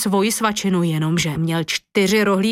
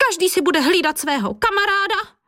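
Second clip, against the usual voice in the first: teacher's instruction; 9 semitones higher than usual. A narrator's reading. Teacher orders children into pairs.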